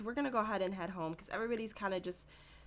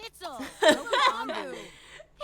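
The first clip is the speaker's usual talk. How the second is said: loud high laugh; 13 semitones higher, 9 dB louder than usual. Real, not acted. Laughing at poor Harmony.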